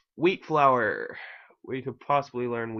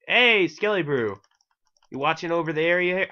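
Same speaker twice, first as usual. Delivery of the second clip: playful loud, raised-pitch hail; calling out; 6 semitones higher, 5 dB louder than usual. Pretend. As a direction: call out higher and louder to a game creature. Calls out to a game mob.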